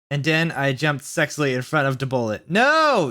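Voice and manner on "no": very high, loud scream of no; yell. A narrator's reading. Everyone screaming as she jumps before the bullet, loud and high. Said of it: "yielded everyone".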